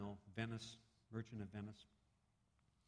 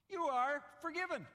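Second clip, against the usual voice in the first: much higher and louder proclamation; booming pardon; 8 dB louder than usual. Acted. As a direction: announce the pardon grandly. Creditor pardons the debt.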